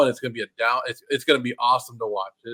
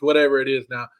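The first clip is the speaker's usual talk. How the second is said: ordinary delivery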